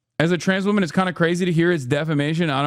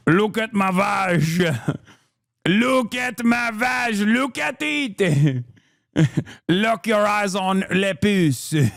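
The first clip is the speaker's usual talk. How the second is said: put-on slow, teasing French accent, higher, through laughter; drawn out in a mocking French lady voice; 5 semitones higher, slower than usual. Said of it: "in the most French way possible".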